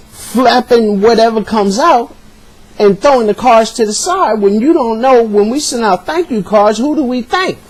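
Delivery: can't believe what she's doing, pitch climbing; exasperated confrontation. Retold or imitated. Confronting wife over the cards.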